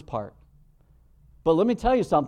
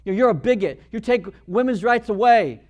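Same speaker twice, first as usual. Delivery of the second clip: mock accusing shout, louder and higher; imitated yelling; 6 semitones higher, 7 dB louder than usual. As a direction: throw the accusations like a heckler. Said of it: "They're gonna start yelling at you".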